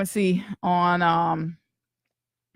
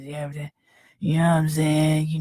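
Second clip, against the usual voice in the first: imitating a deep voice; 3 semitones lower than usual. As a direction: sink into a barely audible bass rumble like him. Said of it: "His, his voice is so deep".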